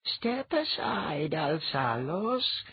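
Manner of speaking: high, slow, firm goddess voice; crisp, no-nonsense. A narrator's reading. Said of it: "crisp, no-nonsense quality".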